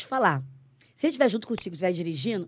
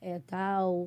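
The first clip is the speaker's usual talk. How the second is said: soft pastor's announcement; 10 dB quieter than usual. Retold or imitated. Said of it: "a pastora veio e falou assim".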